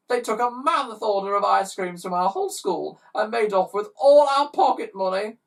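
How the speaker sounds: put-on high loud child blubbering; hammed-up tearful child. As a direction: wail the complaint through tears. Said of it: "blubbered another".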